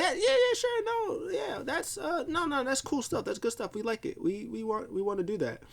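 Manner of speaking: very high, squeaky mock agreement; nervous giving in. Bullied Sami nervously gives in.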